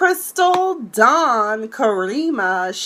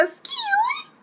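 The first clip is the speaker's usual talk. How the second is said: squeaky excuse me. Real, not acted. Sudden high aside.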